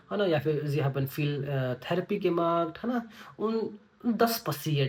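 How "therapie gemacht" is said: ordinary talk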